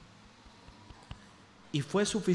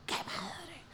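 whispered thought; 3 semitones higher, 14 dB quieter than usual. Retold or imitated. His inner thought at the fuss.

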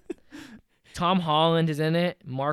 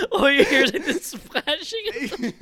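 high, laughing speech; 10 semitones higher, 5 dB louder than usual. Real, not acted. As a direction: talk through helpless laughter. Laughing through the joke.

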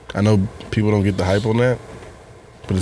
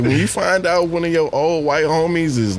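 normal talking voice

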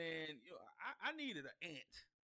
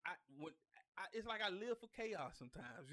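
ordinary delivery

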